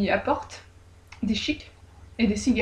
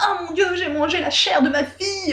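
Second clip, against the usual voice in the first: mère horrifiée; 7 semitones higher than usual. A narrator's reading.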